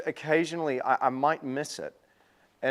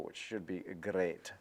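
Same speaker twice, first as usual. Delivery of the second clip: put-on Scottish accent; 7 semitones lower, 11 dB quieter than usual. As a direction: slip into a hint of Scottish. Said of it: "I suddenly became Scottish".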